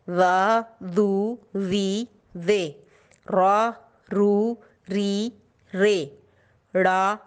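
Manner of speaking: put-on slow sing-song drill of syllables for children; teacher reading a syllable chart aloud. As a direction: read each syllable clearly, like a teacher.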